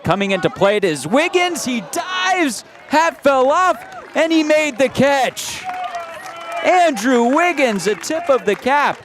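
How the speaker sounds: high-pitched shout of excitement, easing toward the end; excited play-by-play. Real, not acted. Announcer calling Wiggins's diving catch, real excited shouting. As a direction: call the spectacular play at full thrill, voice leaping high.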